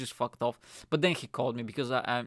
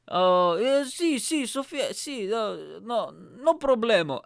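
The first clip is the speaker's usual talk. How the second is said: high, loud mock Spanish driver; hammed-up Spanish voice; imitated Spanish driver; 9 semitones higher, 6 dB louder, slower than usual. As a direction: play a cheery Spanish driver, pitched way up. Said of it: "he was Spanish".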